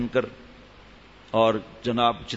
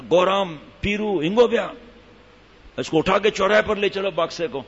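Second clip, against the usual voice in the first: calling the workers; 6 semitones higher than usual. Acted. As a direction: shout out to the labourers. Husband calls his labourers.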